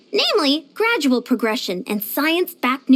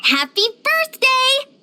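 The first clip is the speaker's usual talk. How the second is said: high, drawn-out birthday cheer; sing-out greeting, pitched well up; cheerful birthday greeting; 11 semitones higher, slower than usual. Acted. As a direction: wish them happy birthday, high and slow. Wishing someone a happy birthday.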